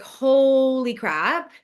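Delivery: slow, drawn-out "Holy crap"; stunned disbelief. Retold or imitated. Wife hearing the deployment news, slow stunned disbelief. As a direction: let it sink in, stretched out. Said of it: "I was like".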